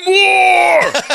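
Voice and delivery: put-on high, loud toddler holler; greedy toddler. Toddler demanding food from his uncle, high drawn-out shout. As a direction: bellow it like an insatiable two-year-old. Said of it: "And he goes".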